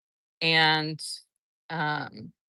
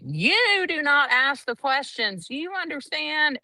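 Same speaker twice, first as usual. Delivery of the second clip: a mimicked stern judge, much higher and slow; 10 semitones higher than usual. Judge scolding the witness who questions her, stern imitation. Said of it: "And the judge was like".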